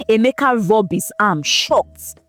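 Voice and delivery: ordinary delivery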